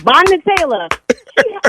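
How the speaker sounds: high, loud exclamation of the name; real outraged disbelief. Real, not acted. Pranked woman learns her friend set her up, astonished. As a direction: cry out the culprit's name, astonished.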